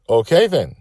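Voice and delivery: high and loud, full of energy; eager child. A narrator's reading. Little girl decides to lead the adventure herself. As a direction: jump straight into it.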